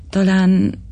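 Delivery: hesitant, uncertain "talán"; half-hearted, trailing maybe; unsure. A narrator's reading. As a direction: baronet answering the chairman, unsure. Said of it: "feleltem bizonytalanul".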